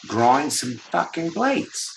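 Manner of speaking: lightly voiced Aussie, lingering on each word; mock Australian. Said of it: "It is Australia".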